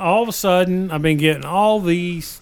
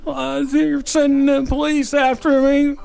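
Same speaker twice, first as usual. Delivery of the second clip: high, slow, put-on old man; hammed-up elderly man on the phone; 8 semitones higher, slower than usual. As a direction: play a worried old man, drawn out and high. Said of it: "in my best old man voice".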